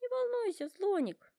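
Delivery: a high reassuring giraffe. A narrator's reading. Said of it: "говорит Жирафа".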